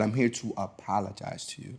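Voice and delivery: soft, hushed apology from mom; quiet retold apology. Mother apologizing to her ten-year-old son, softly. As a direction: hush it like a sincere apology. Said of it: "Mom said".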